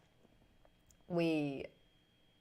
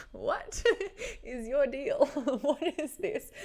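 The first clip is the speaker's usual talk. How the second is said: higher voice broken up by laughter; laughing mock bafflement; 7 semitones higher, slower than usual. Co-host reacting to her radio style, laughing mock bafflement. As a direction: imitate a baffled co-host through giggles.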